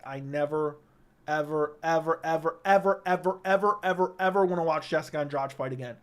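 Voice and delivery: each ever hammered, piling up; ever, ever, ever said with mounting emphasis; stress on ever. Real, not acted. Fed-up streamer stressing never ever watching her.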